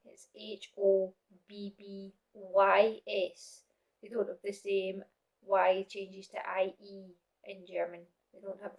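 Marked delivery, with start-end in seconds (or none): 0.1-3.0 "H O B B Y" spelt slowly, letter by letter, rising on the Y; spelling out letters. Shown as a demonstration. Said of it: "if you notice the spelling".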